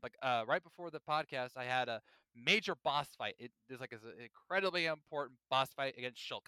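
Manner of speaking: usual speaking voice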